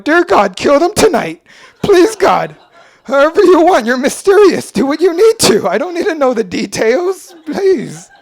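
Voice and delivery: high, loud pleading prayer to God; vengeful prayer. Retold or imitated. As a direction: beg God to smite the bullies. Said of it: "I'll go home and just be like".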